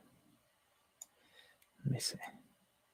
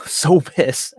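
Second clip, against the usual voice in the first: laughing exasperation; 4 semitones higher, 7 dB louder than usual. Real, not acted. Laughing about his ruined Saturday.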